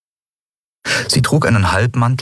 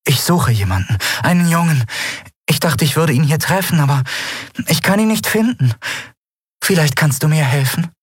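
much higher, sweet voice with a desperate undertone; warm and lovely; 10 semitones higher than usual. A narrator's reading. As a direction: plead sweetly for help finding him. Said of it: "so warm und lieblich".

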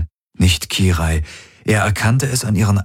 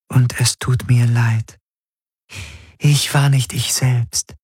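a pained spirit saying sorry; remorseful spirit; 5 semitones higher than usual. A narrator's reading. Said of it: "sagte die Gestalt mit den schwarzen Augen".

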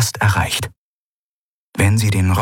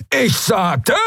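angry shout; 11 semitones higher than usual. Acted. Villain snaps at prisoner.

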